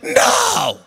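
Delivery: mock demon no; demon refusing. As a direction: snap a defiant no as the demon. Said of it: "And the demon goes".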